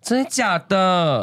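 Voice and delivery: high, drawn-out incredulous reaction; slow, high-pitched "no way" surprise; amazed disbelief. Real, not acted. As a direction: stretch it out in real astonishment. Host reacts to fainting story.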